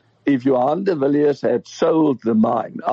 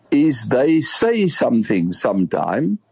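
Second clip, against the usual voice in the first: normal talking voice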